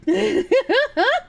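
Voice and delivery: high giggling burst; rising peal of laughter. Real, not acted. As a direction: crack up at your own bit. Laughs at own accent bit.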